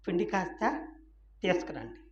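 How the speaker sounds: a gentle, hushed order; quiet command. Acted. God's one quiet word.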